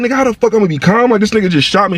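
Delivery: freaking out, convinced he's been shot; panicked yell. Retold or imitated. Panicking man shouts back at girl telling him to calm down. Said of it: "Panicking like a motherfucker".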